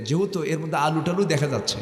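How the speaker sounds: ordinary talk, nothing marked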